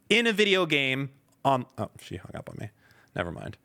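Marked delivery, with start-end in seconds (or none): none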